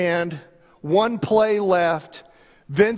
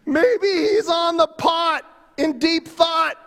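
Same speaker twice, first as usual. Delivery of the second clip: "Maybe he's busy" shouted much higher with mock glee; mocking taunt; 9 semitones higher than usual. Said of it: "Elijah began to taunt them".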